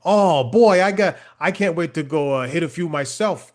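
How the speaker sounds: put-on loud, eager old Maine golfer; imitating a Maine old-timer, keen to play; a touch of a Maine accent, bursting out. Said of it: "I love that you did the accent".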